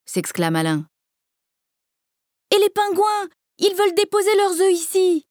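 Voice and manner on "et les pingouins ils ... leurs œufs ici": put-on high child voice, worried; girl's voice. A narrator's reading. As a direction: voice a little girl pleading for the penguins. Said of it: "dit Aude".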